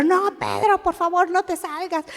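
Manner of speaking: high-pitched mock begging; acted pleading. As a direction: plead as if holding a friend back. Acts disciple begging Peter to stay.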